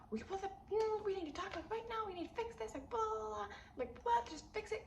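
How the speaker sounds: high, insistent pleading; a high, impatient let's-talk-now; urgent demand. Retold or imitated. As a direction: pitch it high and push to fix it now. Demanding to fix issue now.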